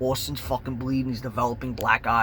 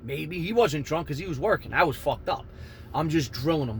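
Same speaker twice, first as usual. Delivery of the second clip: normal talking voice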